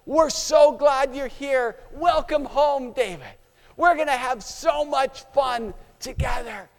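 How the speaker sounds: cheering crowd shouting. Acted. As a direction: cheer him home like a stadium crowd. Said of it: "They start shouting".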